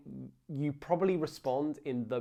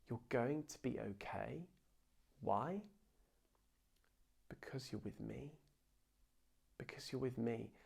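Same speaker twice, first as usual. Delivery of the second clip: tender reassurance; 7 dB quieter than usual. Real, not acted. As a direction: reassure the worried congregation gently.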